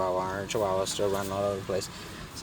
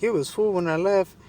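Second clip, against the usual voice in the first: high, louder mock lady voice; imitated woman; 11 semitones higher, 5 dB louder than usual. Lady protests about her radiator fluid, in mock high voice. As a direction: mimic a woman insisting, pitched right up. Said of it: "And she's like".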